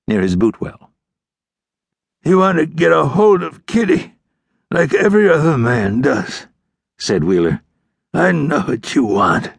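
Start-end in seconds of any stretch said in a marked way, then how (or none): 2.2-6.5 high, strained outlaw voice; taunting dying outlaw. A narrator's reading. Dying outlaw taunting the ranger about Kitty, strained. 8.1-9.5 slow, high, strained taunt; knowing dying outlaw. A narrator's reading. Dying outlaw claiming to see through the ranger, taunting.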